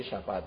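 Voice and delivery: «شفاعت» said sha-fa-'at; shafa'at with fatha. Shown as a demonstration. Teacher restating the correct word, «شفاعت» as shafa'at. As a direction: put "a", not "e", after the sh of «شفاعت». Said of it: "به فتح شین".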